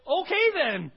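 loud, high, firm resolve; waking up and deciding to believe, shouted; decisive exclamation. Acted.

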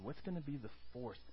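normal talking voice

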